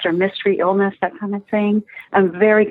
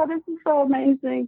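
tearful awe; 4 semitones higher, 4 dB quieter than usual. Retold or imitated. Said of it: "And they were in tears".

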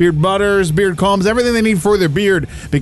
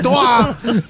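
higher, hammed-up French 'Detroit'; French accent; 5 semitones higher than usual. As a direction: echo the name in mock French. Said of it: "make that more French".

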